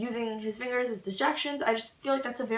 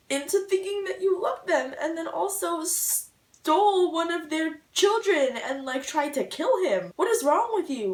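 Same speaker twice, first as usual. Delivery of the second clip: outraged; 6 semitones higher, 3 dB louder than usual. Real, not acted. Outraged rant about villain Malcolm.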